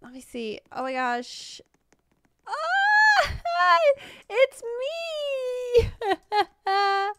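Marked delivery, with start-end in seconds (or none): 2.5-7.1 very high, laughing squeal of joy; delighted squeal. Real, not acted. Streamer spotting a statue of herself, squealing and laughing. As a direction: shriek with happy laughter. Sees her own likeness.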